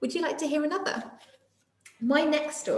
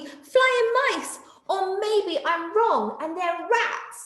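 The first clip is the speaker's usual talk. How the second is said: high, slow worried voice; unsure and frightened; scared monkey; 9 semitones higher, much slower than usual. Acted. Day Monkey scared of bats.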